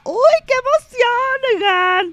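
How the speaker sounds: very high, loud, drawn-out squeal; excited squeal. Real, not acted. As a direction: squeal it with delight. Excited cry at hundredth episode.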